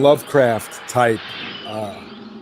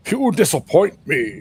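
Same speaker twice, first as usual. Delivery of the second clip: lightly voiced movie villain, a bit higher; a hint of a menacing space villain; Dark Helmet voice; 4 semitones higher than usual. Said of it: "That sounds like Dark Helmet's voice from Spaceballs".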